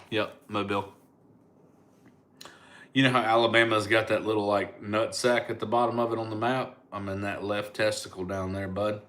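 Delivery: normal talking voice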